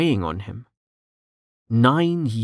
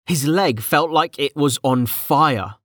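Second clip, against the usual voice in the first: ordinary delivery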